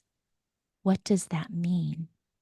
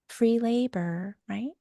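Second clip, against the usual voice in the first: ordinary talk, nothing marked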